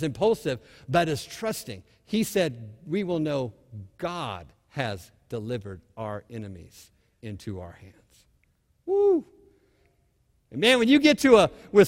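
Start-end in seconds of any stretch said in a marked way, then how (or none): 2.9-8.2 hushed certainty. Acted. Jonathan's trusting declaration. 8.9-9.3 sudden high whoop of joy; excited whoop. Real, not acted. Preacher's excited cry.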